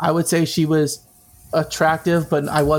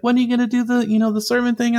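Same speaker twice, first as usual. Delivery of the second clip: eager; 7 semitones higher than usual. Retold or imitated. Asking her eagerly.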